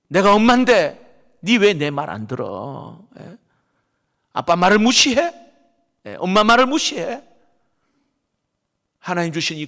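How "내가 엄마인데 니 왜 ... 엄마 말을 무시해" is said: put-on loud, higher, angry parent scolding a child; demanding why the child ignores them. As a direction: bark it at the kid like an offended father. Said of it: "부모의 권위를 잘못 사용하는 거예요".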